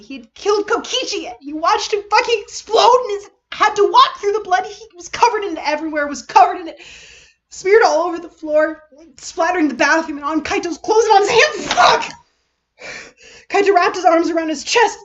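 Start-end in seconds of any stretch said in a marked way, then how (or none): 0.0-1.3 rising panic. Acted. Panic realisation, repeated. 1.4-11.7 loud, pitched-up, breathless telling; the words tumble out in horror; panicked narration. A narrator's reading. Narrator reliving Kaito's horror in a loud panicked rush. 11.7-12.1 sudden loud shouted curse; panicked curse. Acted. Kaito swearing aloud in panic.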